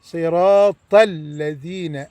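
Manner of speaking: صراط الذين slowly, the ذ with the tongue between the teeth; slow صراط الذين, clear interdental ذ. Shown as a demonstration. Teacher showing the ذ with the tongue out, slowly. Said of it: "اطلع لساني في الذال".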